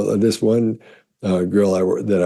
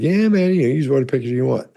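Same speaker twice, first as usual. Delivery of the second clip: excited friend; 7 semitones higher than usual. Retold or imitated. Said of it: "So he got all excited".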